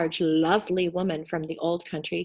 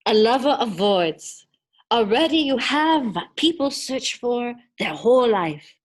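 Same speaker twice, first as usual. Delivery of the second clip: booming genie; 4 dB louder than usual. Acted. Genie to the lonely boy Selig, booming and grand. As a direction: proclaim like a mighty genie granting purpose. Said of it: "the genie disappeared".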